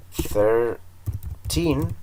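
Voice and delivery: put-on thirteen with the weight on -TEEN, drawn out; stress on the last syllable. Shown as a demonstration. Teacher modelling number stress for students, thirteen stressed on TEEN. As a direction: say thirteen landing hard on TEEN. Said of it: "El acento siempre va al final".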